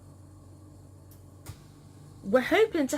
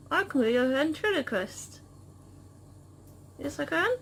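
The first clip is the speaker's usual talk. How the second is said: ventriloquist voice; 4 semitones higher than usual. Pretend. Ventriloquist bit with pet.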